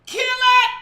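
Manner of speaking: high loud shout of Kill it; sudden high loud command. Real, not acted. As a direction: yell it out high.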